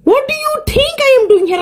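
high-pitched, exasperated comic retort; indignant outburst. Pretend. Snapping back at a nosy English practice partner, indignant. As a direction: shoot the question back high, as if insulted.

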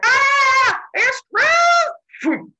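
very high, loud shrieked words; dying scream. Retold or imitated. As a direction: scream at a blinding sight. Semele cries out while vaporizing.

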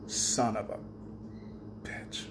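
low, soft curse fading away; low muttered curse. Retold or imitated. Looking down at his ripped toe, a low soft curse. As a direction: curse under the breath at the toe. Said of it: "I'm like".

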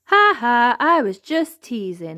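loud spoken ha-ha, lightly amused; mildly laughing. A narrator's reading. Elmer admits teasing Wilbur, mildly laughing. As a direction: let a teaser's amusement show. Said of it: "laughed Elmer".

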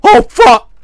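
sudden high, very loud curse; jump-scare outburst; startled yell. Real, not acted. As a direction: yell it out in shock. Startled in horror game.

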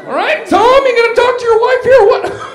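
loud, high yelling; angry confrontation. Acted. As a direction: shout a challenge at the husband. Yelling at rival's husband.

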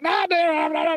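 high-pitched mock argument; childish bickering. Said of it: "going back and forth like kids".

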